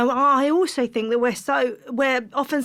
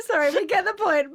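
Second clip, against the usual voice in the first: ordinary talk, nothing marked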